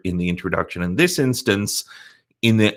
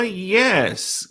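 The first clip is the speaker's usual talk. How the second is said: normal talking voice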